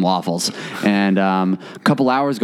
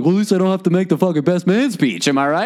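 joking; 6 semitones higher than usual. Retold or imitated. Buddy making dark jokes.

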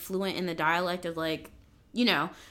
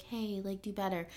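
gentle soft nudge; 9 dB quieter than usual. Retold or imitated. Gentle version of feedback.

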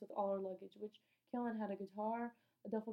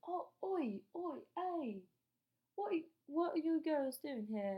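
higher, mock British men calling out; creepy British men; 6 semitones higher, 5 dB louder, slower than usual. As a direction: imitate leering pub men greeting girls. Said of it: "everyone's like".

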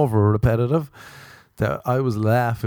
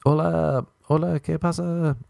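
higher, mock Mexican accent; hammed-up Mexican Spanish greeting; 7 semitones higher than usual. Shown as a demonstration. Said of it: "That's more Mexican".

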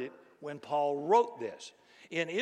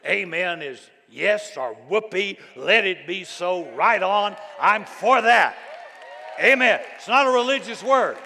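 loud exclamations building higher; emphatic preaching; 3 semitones higher, 9 dB louder than usual. Shown as a demonstration. Preacher urging congregation to say amen, building to a shout.

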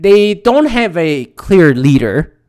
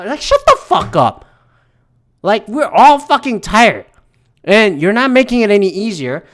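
fed-up, higher-pitched angry tirade; angry rant; 4 semitones higher than usual. Real, not acted. Ranter telling truckers to get off the road, angry. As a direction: rant at them, exasperated and emphatic. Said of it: "That's my rant".

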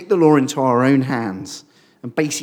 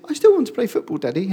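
put-on much higher, eager child's plea; little boy; 7 semitones higher, faster than usual. Son asking his dad to stay and play, eager child. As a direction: sound like a five-year-old begging to play. Five-year-old son pleads.

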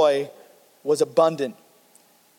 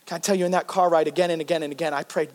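normal talking voice

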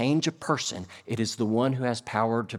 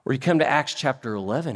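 ordinary talk

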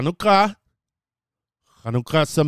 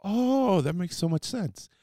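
sudden realisation; 6 semitones higher, 4 dB quieter than usual. Acted. Teacher voicing listeners putting two and two together, sudden realisation. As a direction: act out a listener who just got it. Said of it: "you're like".

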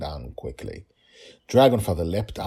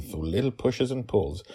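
usual speaking voice

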